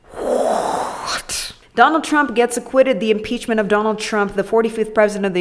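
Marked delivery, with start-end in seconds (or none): 0.1-1.5 whispered, shocked What; whispered disbelief. Real, not acted. Reacting to a billion animals dying, in a stunned whisper. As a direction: breathe it out in disbelief.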